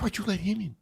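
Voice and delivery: soft, high, accusing undertone; re-enacted hushed scolding; urgent hushed question. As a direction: hiss it under your breath. Hushed confrontation with friend.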